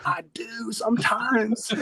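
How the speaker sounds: high, drawn-out mock comeback; comic high protest. Teen answering training partner's taunt, high comic protest. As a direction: squeal it slowly through a laugh.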